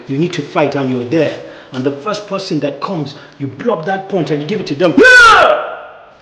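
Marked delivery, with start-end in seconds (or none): 5.0-6.1 full-throated high yell; battle shout. Shown as a demonstration. Coach demonstrating the punch shout, full yell. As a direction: scream it as you strike. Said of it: "you're going to have to scream that".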